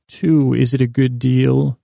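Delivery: usual speaking voice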